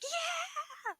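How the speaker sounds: high, loud crowd roar; cheering crowd. Acted. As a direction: yell it like a spectator going wild. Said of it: "the crowds just like".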